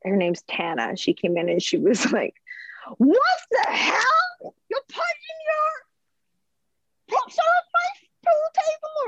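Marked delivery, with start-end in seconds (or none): 3.0-9.1 high, slow, hammed-up posh British outrage; horrible posh British accent. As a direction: badly fake a posh British accent, pitched way up. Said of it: "probably very posh and that was a horrible".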